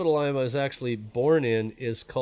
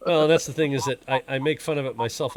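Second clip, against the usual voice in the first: ordinary delivery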